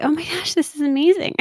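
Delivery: suddenly thrilled, anger gone; delighted surprise. Retold or imitated. Relief as anger suddenly leaves.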